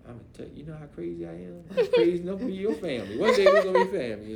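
fast, laughing comeback; laughing fast retort. Retold or imitated. Answering the teen niece, fast and laughing. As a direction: toss it back, laughing.

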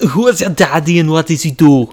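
hammed-up Austrian strongman; Arnold impression. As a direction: ham up a thick Austrian accent. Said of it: "You didn't sound anything like Arnold".

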